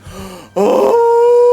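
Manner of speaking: sudden loud high gasp of realization; sharp gasp. Real, not acted. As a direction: gasp as the danger hits you. Realizing a big mistake.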